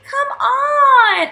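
high, loud exasperated plea. Pretend. Big sister to sleeping Skipper, exasperated. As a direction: whine it in play exasperation.

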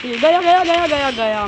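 loud, high repeated cry, easing down; excited kill callout. Real, not acted. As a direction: burst out as the enemy goes down. Calls a kill as it happens. (जोश में चिल्लाकर)

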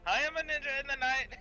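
high, sing-out mock boast; hammed-up high ninja voice; playful ninja boast. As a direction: announce it high and silly. Joking, playing a ninja.

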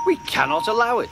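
high, quick, outraged declaration; indignant outrage. A narrator's reading. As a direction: put your foot down, high and fast. Engines outraged at Duck's tales.

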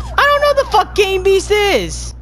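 loud, high-pitched outburst; fed-up high yell; exasperated shout. Real, not acted. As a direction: yell it back, pitch jumping up. Exasperated reply to pestering player.

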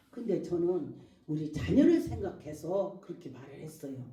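ordinary talk, nothing marked

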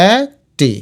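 long gap inside একটি; split in syllables. Shown as a demonstration. Teacher sounding out the spelling, একটি split aek-ti. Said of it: "এটাও একটি হবে".

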